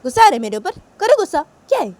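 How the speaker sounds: high, loud mocking dare; taunting girl. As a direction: taunt him to get angry. Uma taunting Sanju. (ऊँची तेज़ चिढ़ाती आवाज़)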